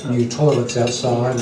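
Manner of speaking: ordinary talk, nothing marked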